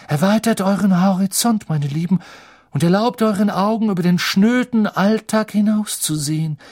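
high, loud theatrical proclamation; calling out. A narrator's reading. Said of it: "rief Professor Trelawney durch die Düsternis". (laut und hoch rufend)

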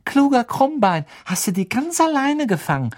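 high-pitched doting praise; praising a pet. A narrator's reading. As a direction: coo proudly at a pet. Hermine lobt ihren Kater. (hoch und stolz lobend)

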